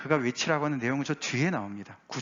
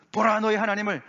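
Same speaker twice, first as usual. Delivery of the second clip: raised proclaiming call; crying out; 7 semitones higher, 4 dB louder than usual. Acted. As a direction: announce it like a herald. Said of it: "라고 외치라고".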